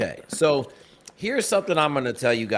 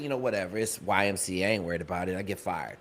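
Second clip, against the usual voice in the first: shrugging it off; 7 semitones lower, 8 dB quieter, faster than usual. Retold or imitated. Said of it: "So I said".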